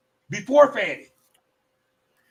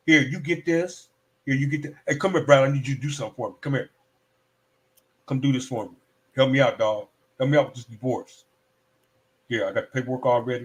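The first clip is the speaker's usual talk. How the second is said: a lower, casual boss voice; imitating Wade; 7 semitones lower than usual. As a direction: play the big man dishing out favours. Voicing Wade handing out favours.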